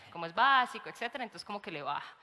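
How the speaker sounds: usual speaking voice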